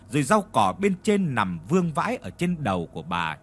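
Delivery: plain reading voice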